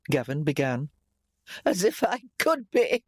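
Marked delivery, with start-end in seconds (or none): 1.6-3.0 high, strained croak of reproach. A narrator's reading. Sick mother reproaching her son, croaking. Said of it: "their mother croaked".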